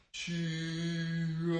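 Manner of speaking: long drawn-out karate cry; slow-motion kiai. Shown as a demonstration. Coach punching in slow motion with kids, slow-motion kiai. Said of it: "I like that slow motion key out".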